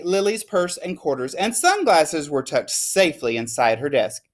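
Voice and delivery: plain reading voice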